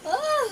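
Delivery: high, loud yelp of pain; sudden cry as wax pulls; sharp pain yelp. Real, not acted. (sigaw sa sakit)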